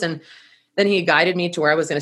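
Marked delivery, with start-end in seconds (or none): none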